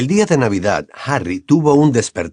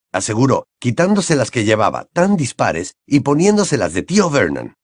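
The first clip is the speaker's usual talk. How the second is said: plain reading voice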